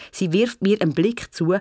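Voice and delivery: ordinary delivery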